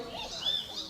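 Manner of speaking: high witch cackle; evil cackle. Acted. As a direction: cackle wickedly. Witch laughs at captives.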